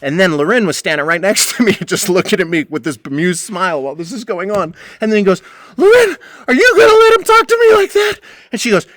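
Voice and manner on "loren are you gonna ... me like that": much higher, louder mock old-man squeal; hammed-up outraged squeal; whiny old man shriek. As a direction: whine to the boss like a wronged old man. Butch appeals to Loren.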